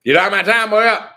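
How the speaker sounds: high, loud, slurred-together mock holler; unintelligible Louisiana phone greeting. Friend's dad answering the phone instead of hello, imitated garbled holler. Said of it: "instead of saying hello, he'd go".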